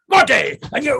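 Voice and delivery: short, high acted order to attack; shouted command. Instructor stepping back and ordering attack, shouted command.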